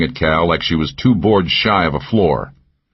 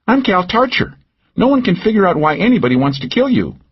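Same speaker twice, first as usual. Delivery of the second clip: higher, teasing woman's voice; Cal's voice; 14 semitones higher than usual. A narrator's reading. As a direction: needle him with a pitched-up lilt. Narrator voices Cal.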